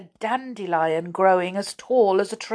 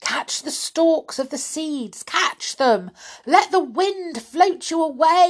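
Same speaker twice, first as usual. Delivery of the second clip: yell; 9 semitones higher than usual. Acted. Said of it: "yelled Saucepan".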